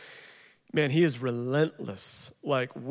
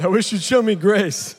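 put-on higher, louder pleading little-boy voice; mock child plea; 4 semitones higher, 6 dB louder than usual. Son begs dad for grace to dodge a spanking, hammed-up child plea. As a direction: play the gospel trump card like a sly four-year-old. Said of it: "four-year-old trump card".

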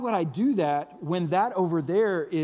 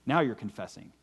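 ordinary talk

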